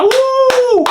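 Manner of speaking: high, loud woo; mock cheer. Secretary cheering the foreman, high woo. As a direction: squeal like an eager secretary.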